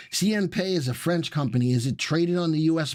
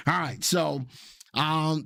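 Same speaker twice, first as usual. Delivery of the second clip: normal talking voice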